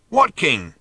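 shouted question. A narrator's reading. Ford asks Arthur about the king, shouting. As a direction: call back baffled.

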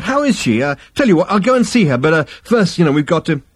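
high, loud, hesitant babble; flustered placating. A narrator's reading. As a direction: try to wriggle out politely, rattled. Zaphod hurriedly placates his ancestor.

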